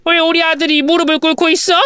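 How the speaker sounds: high-pitched indignant cry; yelling mother-in-law. Acted. Mother-in-law finding her son kneeling before his wife, yelling. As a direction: burst in with a high acted yell. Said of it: "저한테 냅다 소리를 지르시더라고요".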